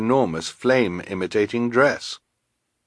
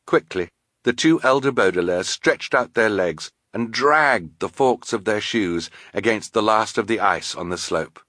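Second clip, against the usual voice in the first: usual speaking voice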